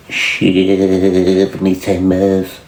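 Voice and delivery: very slow, low sailor drawl; Popeye. Acted. Asking who says the catchphrase, low drawn-out cartoon voice. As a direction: drag out a long first word like a seadog. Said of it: "Fucking Popeye".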